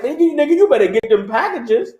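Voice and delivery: normal talking voice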